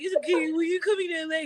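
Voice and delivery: high, mock-tearful little voice; pitiful whining. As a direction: put on a wounded, pleading tone. Retells her whiny reply on call.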